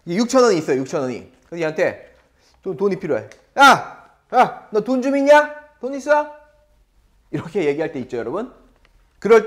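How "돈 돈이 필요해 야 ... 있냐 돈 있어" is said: loud playful call to a friend; mock demand. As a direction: yell yah at the friend, half joking. Teacher acts asking friend for money.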